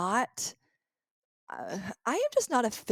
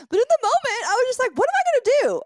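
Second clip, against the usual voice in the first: high, loud panicked cry; 10 dB louder, faster than usual. Retold or imitated. Reliving the panic of the moment in a high, loud cry. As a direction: panic as if locked out right now.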